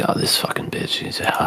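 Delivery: whispered seething aside; mock whisper. Elise fuming about prissy Otaha, whispered mock grumble. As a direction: hiss it under your breath at the rival. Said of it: "she's just like".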